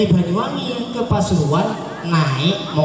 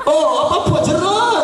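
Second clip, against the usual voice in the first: laughing; 10 semitones higher than usual. Acted. Preacher joking with the crowd while laughing.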